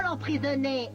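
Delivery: ordinary talk, nothing marked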